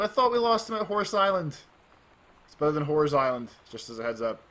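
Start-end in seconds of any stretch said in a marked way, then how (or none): 0.0-1.6 high, loud captain's shout; yelling captain. Acted. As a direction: call out across the water in alarm. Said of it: "She yelled".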